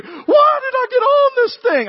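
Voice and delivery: very high, slow yell of someone regretting the ride; mock terrified yell. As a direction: wail it like you're dropping down the tower. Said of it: "both of them are yelling".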